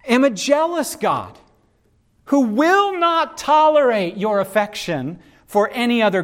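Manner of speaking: solemn Bible verse read aloud; scripture reading. Acted. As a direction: lay the commandment out like scripture. Reads the second command aloud.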